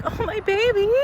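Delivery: put-on high cooing baby talk; soothing high coo at her crying boyfriend; pitying coo. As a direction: coo over him like a hurt child.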